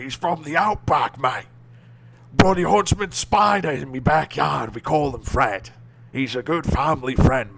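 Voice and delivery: slow, hammed-up Australian accent, stretched out; mock Australian accent. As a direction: play a cartoon Aussie from the outback, Australian accent throughout. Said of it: "Russian Aussie?".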